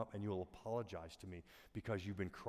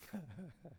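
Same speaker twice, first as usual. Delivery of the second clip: short laugh; 7 semitones higher, 10 dB quieter than usual. Real, not acted. Laughs at own bladder story.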